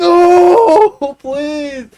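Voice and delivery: hammed-up begging not to be sent out; mock pleading wail. Retold plea to teacher.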